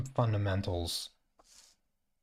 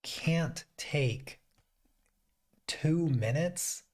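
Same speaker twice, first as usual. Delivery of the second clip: higher, slow, stressing "two minutes"; exasperated stress; 8 semitones higher, much slower than usual. Real, not acted. Ranting at the announcers, stressing "two minutes". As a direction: lean on "two minutes" in disbelief.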